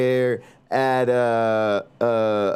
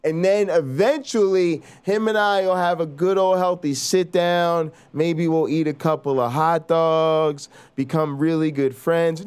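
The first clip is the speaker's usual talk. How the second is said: ordinary delivery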